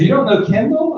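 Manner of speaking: high incredulous question; can't believe he doesn't know; woman surprised a guest doesn't know the bride; surprised disbelief. Retold or imitated. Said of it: "She goes".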